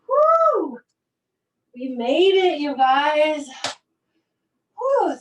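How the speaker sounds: high, loud whooping cheer; aerobics instructor. Real, not acted. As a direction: celebrate finishing the set. Cheering at end of set.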